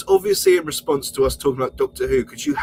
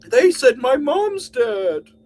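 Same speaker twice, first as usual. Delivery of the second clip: high, loud, slow whine; mock whining victim; 11 semitones higher, 6 dB louder, much slower than usual. Mocking Joe's complaint, high and slow. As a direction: drag out the self-pity. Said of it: "mocking Joe's complaint".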